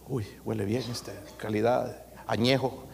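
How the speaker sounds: savoring wine. Retold or imitated. Guests smelling the aged wine, soft and relishing. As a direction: linger over the aroma.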